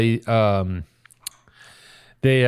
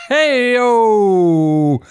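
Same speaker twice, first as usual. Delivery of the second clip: loud, high drawn-out Hey-o; hey-o cheer; 11 semitones higher, 7 dB louder than usual. Acted. Host cheering his own pun, loud and drawn out. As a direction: holler it like a sidekick.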